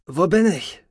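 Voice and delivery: high, startled question; bewildered waking. Acted. Patient waking from coma, bewildered. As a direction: ask it high, just come round.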